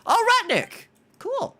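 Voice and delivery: high, loud exclamation; sudden high-pitched shout of recognition; excited call-out. Real, not acted. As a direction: squeal the name in surprise. Calls out greeting someone.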